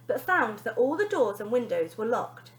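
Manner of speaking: usual speaking voice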